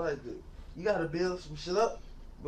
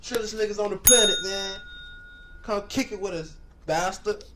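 hype shout-out; 4 semitones higher, 6 dB louder than usual. Real, not acted. Loud hype outro shout-out.

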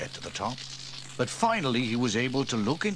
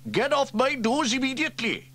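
much higher, loud indignant shout; angry shout; 6 dB louder than usual. Acted. Sam shouts at the bird on his nose, angrily. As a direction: order the bird off furiously. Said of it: "he shouted".

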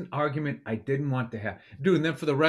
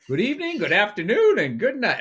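sing-song movie farewell, swooping pitch; big mock-cheery greeting that starts high and steps down; Jim Carrey; 4 semitones higher than usual. Waving to the neighbor like the movie character, chirpy impression. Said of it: "like Jim Carrey in that movie".

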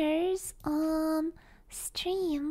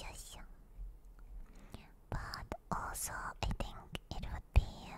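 soft breathy whisper close to the mic; ASMR whisper; 3 semitones lower, 6 dB quieter than usual. Acted. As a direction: whisper as if lulling viewers to sleep. Whispered ASMR stream chat.

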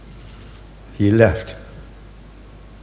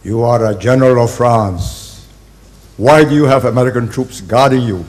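loud scolding quote, a bit higher; berating; 4 semitones higher, 9 dB louder than usual. Retold or imitated. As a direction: retell de Gaulle's dressing-down with force. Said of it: "he berated the man and says".

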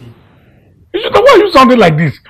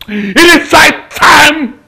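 slow, raised, drawn-out declaration; shouted resolve; 5 semitones higher, much slower than usual. Acted. Betrayed uncle resolving to end it, shouted resolve. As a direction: lift it high and stretch each word.

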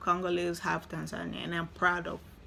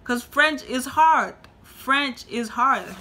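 slow, drawn-out and emphatic on "hard"; emphatic French is hard; 5 semitones higher, 5 dB louder, slower than usual. Real, not acted. To viewers who studied French, slow emphatic insistence. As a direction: lean on "hard" and stretch it out. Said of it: "Do y'all know why?".

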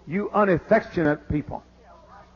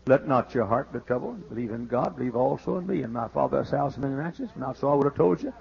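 rattled off by rote; 7 semitones lower, 6 dB quieter, faster than usual. Shown as a demonstration. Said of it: "We all know that in the mind".

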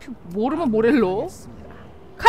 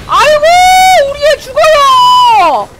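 much higher, louder, drawn-out wail; mock wailing mother; 13 dB louder, slower than usual. Gamer hams up a wailing mother over his dying champion. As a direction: cry out like a frantic mother for her child.

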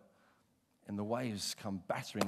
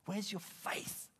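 gentle rebuke; 8 semitones higher, 5 dB quieter, much slower than usual. Retold or imitated. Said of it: "rebukes them and says".

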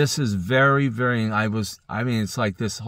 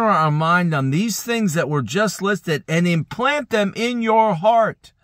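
rising fervor; 9 semitones higher, 4 dB louder than usual. Real, not acted. Pastor urging listeners to implant the verse, high and fervent. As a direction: lift it up with conviction. Preacher's voice climbs at the end.

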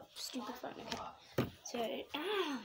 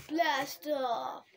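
louder excited blast off call; excited shout; 6 dB louder than usual. Real, not acted. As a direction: shout it like a rocket launch. Countdown ends.

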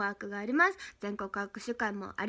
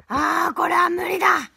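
loud, higher cry of giving up; exasperated shout; 5 semitones higher, 7 dB louder than usual. Real, not acted. To chat, giving up on reading the gifts, shouted. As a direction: throw up your hands and shout it.

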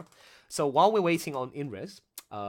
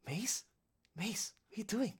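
panicked plea; 8 semitones higher, 7 dB louder than usual. Retold or imitated. Host imitating Tatiana pleading with Mace, building to a cry. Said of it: "Tatiana was saying".

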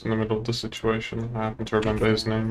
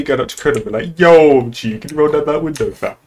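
louder, higher mock gangster slang; 4 semitones higher, 6 dB louder than usual. Lawyer mocking street talk to a colleague, loud mock gangster. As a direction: ham up a street gangster talking to a cop.

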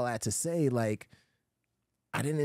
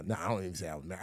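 ordinary talk

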